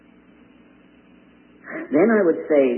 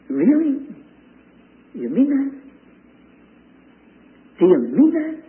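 rising high, disbelieving "really?"; incredulous; 12 semitones higher, 3 dB louder than usual. Real, not acted. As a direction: challenge them in mock disbelief. Incredulous challenge.

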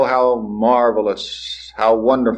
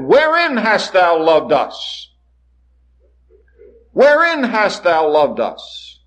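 higher, slow, mocking challenge; cynical sarcastic question; 8 semitones higher, slower than usual. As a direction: drag it out like a disbelieving sneer. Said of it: "cynical, sarcastic inflection in their voices".

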